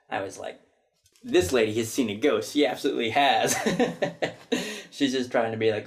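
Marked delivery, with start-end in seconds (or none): 1.2-3.6 laughing while loudly insisting. Real, not acted. Laughing at the lady who denied seeing ghosts. 3.6-4.8 high burst of laughter. Real, not acted. Laughing at the ghost-shy lady.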